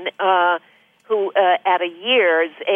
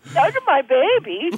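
put-on high cooing baby talk to a pet; dog voice; 9 semitones higher than usual. Shown as a demonstration. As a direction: coo it like talking to your dog. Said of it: "we gotta give you an example of it".

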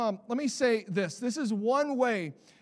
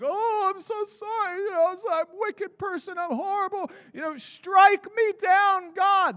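high, whiny self-pitying wail that builds to a shout; a hammed-up wretch rising to a yell on strike me down; mock groveling penitent; 11 semitones higher, 5 dB louder than usual. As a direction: ham up a melodramatic sinner begging God to strike him. Mocking groveling self-pity prayer.

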